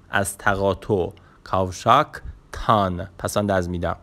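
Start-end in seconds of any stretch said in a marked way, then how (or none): 1.4-3.1 "کاوشاکتان" slowly, with a pause before تان; suffix set apart. Shown as a demonstration. Teacher giving directions at the junction, "کاوشاکتان" split before تان.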